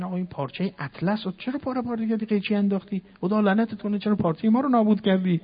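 high, fast, indignant complaint; outraged cry, pitched well up; angry customer. Retold or imitated. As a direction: scold the tailor for ruining the cloth.

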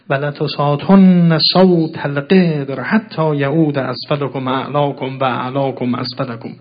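slow, loud Arabic declamation; sermon recitation. Acted. Preacher quoting the Imam's sermon, loud solemn recitation. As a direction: draw out the heavy syllables with force.

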